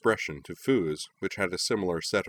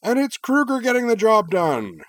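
much higher, louder play-by-play yell; sportscaster roar; 8 dB louder than usual. A narrator's reading. Commentator roaring the winning goal to the crowd, loud. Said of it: "Professor Jim roared".

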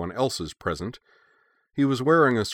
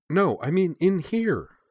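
high-pitched, drawn-out insistence; slow, emphatic high-voiced correction; insistent; 11 semitones higher, slower than usual. A narrator's reading. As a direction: insist with rising exasperation, stretching the words. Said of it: "Shizuka insisted".

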